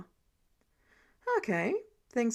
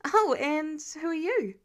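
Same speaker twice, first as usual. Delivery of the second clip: cheery greeting; 5 semitones higher, 3 dB louder than usual. Acted. Karen turning bright to newcomer.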